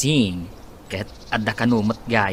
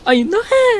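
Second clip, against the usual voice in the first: excited; 6 dB louder than usual. Acted. Friend delighted by news.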